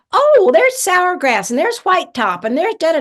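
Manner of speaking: high, loud-starting plant roll call; excited aunt. Retold or imitated. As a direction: imitate an aunt pointing out finds. Aunt naming plants, acted.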